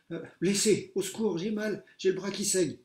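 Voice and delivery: high loud pained plea; wounded pupil begging for help; injured and scared. A narrator's reading. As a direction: cry out hurt.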